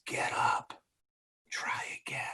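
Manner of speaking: breathy whisper, urgent then fading; whispered inner voice. Acted. Inner whisperer urging you to get up, whispered. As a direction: whisper it like a voice deep in the soul. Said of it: "And then there's the whisperer".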